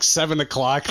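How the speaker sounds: usual speaking voice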